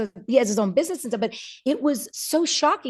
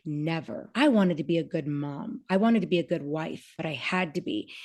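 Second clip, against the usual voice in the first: quiet; 5 semitones lower, 5 dB quieter than usual. Retold or imitated. Mother confesses to daughter.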